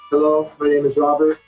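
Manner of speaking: usual speaking voice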